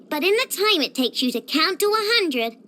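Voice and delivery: ordinary talk, nothing marked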